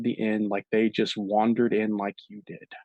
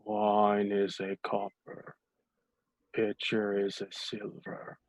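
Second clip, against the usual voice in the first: bleary bartender; 2 semitones lower, 8 dB quieter, slower than usual. Acted. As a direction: drone it out half-awake, like he's barely there.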